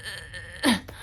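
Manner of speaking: strained, quiet pushing grunt; imitated pushing strain. New mother showing how she pushed, straining noise. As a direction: act out bearing down hard. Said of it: "like taking a big shit".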